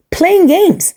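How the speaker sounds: high, incredulous echo of the whisper; high repeat. Real, not acted. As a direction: throw it back up high in disbelief. Repeats it loudly.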